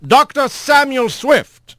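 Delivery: slow formal summons; calling out. A narrator's reading. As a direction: call the name across court. Calls out witness.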